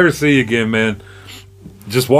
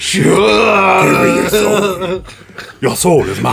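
Shang Tsung voice; 5 semitones higher than usual. Pretend. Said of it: "Shoulda Shang Tsung him".

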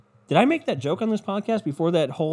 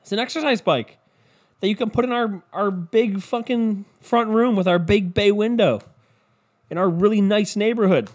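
high, sweet mock-husband voice; husband presenting a gift; 7 semitones higher, 4 dB louder, slower than usual. Husband gives his wife an exercise bike, in a high mock-husband voice. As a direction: pitch it up, drawn out, presenting the gift. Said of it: "You're just like".

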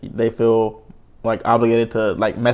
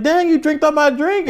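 mock dismay. Retold dismay at daughter.

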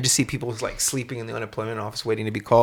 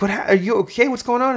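higher startled questions; bewildered and a bit rattled; confused kid; 12 semitones higher than usual. Retold or imitated. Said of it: "I was like".

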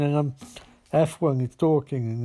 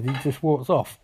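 ordinary talk

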